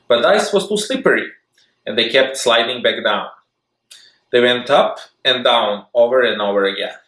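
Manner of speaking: ordinary delivery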